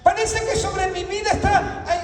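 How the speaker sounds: high, shouted lament from the pulpit; impassioned preaching. Acted. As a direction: voice a believer's complaint at full preaching force.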